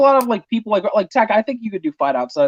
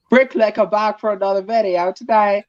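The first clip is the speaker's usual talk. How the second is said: put-on Brick Clicker's accent; 4 semitones higher than usual. Co-host attempting a fellow YouTuber's video intro, imitated accent. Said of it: "I can't do Brick Clicker's accent".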